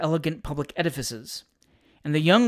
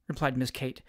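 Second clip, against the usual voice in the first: ordinary delivery, nothing marked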